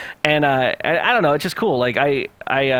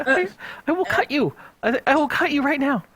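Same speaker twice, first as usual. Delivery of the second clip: high-pitched playful menace; mock threat; 12 semitones higher than usual. Streamer answering a timid newcomer's question, high mock threatening. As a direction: threaten them jokingly in a raised, strained pitch.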